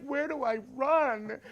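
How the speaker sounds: high, slow bewildered question; confused. Retold or imitated. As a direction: ask helplessly, lost on the field. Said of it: "I'm like".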